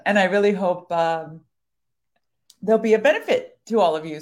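talking through a choking cough; choking on a drink. Real, not acted. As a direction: voice straining from choking. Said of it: "Sorry, I'm choking".

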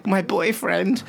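high, mock camp caller voice; a prank caller complaining in a camp voice. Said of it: "he's like".

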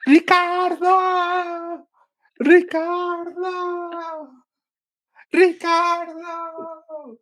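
high, long-drawn call across the aisles; imitated mom's yell. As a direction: stretch the name out high, like calling a lost kid. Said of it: "through the aisles yelling my name".

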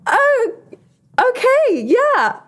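high, awkward oh okay yeah. Retold or imitated. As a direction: sound stunned and uncomfortable. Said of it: "I was like".

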